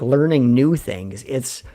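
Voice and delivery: normal talking voice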